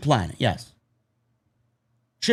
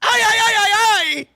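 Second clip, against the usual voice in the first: very high, panicky robot yelps, slowing at the end; shrill panicking sidekick robot cry; Alpha impression; 3 dB louder than usual. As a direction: squeal it like a frantic little robot. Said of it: "sounded half Alpha and half Mexican".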